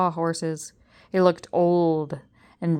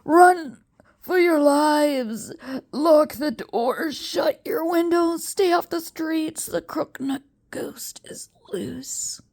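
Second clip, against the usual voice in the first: high, slow frightened pleading fading to a whisper; desperate croaked warning; 9 semitones higher, slower than usual. Acted. Choking professor warns the crowd, high then whispered. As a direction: warn them with your last breath. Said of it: "he croaked".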